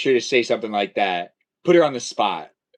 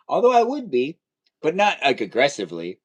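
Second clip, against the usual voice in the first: normal talking voice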